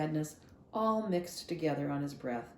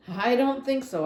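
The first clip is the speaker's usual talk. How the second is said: louder, stubborn no; defiant refusal; 7 semitones higher, 7 dB louder than usual. Acted. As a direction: dig your heels in. Opal refusing.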